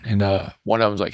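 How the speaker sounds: ordinary delivery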